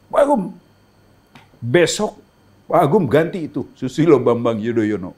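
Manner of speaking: mock presidential order out of nowhere; imitating the president. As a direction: announce it like a boss springing news. President announces reshuffle.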